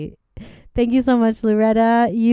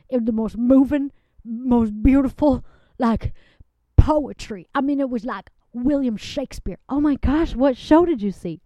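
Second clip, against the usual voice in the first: ordinary delivery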